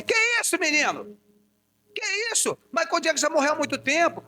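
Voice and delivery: high, scandalised mother voice; mother's disbelief. Retold or imitated. Mother scolds her son about [name], high and mock outraged. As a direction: play the mum, mock outraged. Mother reacting to brother's claim.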